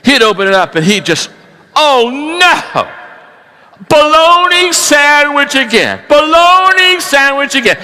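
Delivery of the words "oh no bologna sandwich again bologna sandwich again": whiny worker. Acted. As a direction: wail it like a fed-up worker at lunch. Worker's outburst in a joke.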